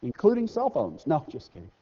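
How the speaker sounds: ordinary talk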